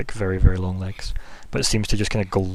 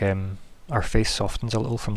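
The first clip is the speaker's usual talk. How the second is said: ordinary talk, nothing marked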